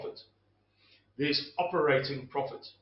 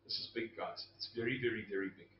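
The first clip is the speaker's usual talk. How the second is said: whispering; 8 semitones lower, 12 dB quieter, faster than usual. Real, not acted. Hushed emphasis on big news.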